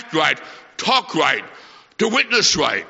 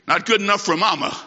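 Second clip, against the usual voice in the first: usual speaking voice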